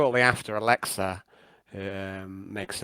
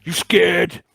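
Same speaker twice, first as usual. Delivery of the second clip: loud then hissed orc threat; menacing orc taunt; 6 semitones higher, 7 dB louder, much slower than usual. Acted. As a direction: sneer like a brute. Orc taunting the player characters.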